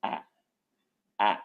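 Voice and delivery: ए said as the short vowel of tan; ए voiced as a clipped short a, like in tan; teacher sounding out ए. Shown as a demonstration. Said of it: "जब हम इसका उच्चारण करते हैं तो ए".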